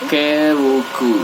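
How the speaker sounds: usual speaking voice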